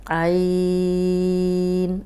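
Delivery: عین eased out slowly from the throat, no jolt; smooth drawn-out عین; gentle ain. Shown as a demonstration. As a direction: let عین flow out gently. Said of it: "آرام سے نکل آئے گا".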